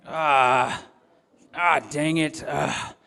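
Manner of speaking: imitated heavy, exasperated sigh-grunt; stressed-out sigh. Dad showing his audience the sigh his daughter mocked, stressed-out grunting. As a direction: act out groaning sighs with a muttered curse. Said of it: "a stressed out sigh".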